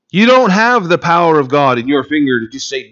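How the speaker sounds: ordinary delivery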